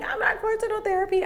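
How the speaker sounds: high, defiant no way; defiant refusal. Retold or imitated. Rejecting her friend's therapy advice, high and defiant. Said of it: "I'm like".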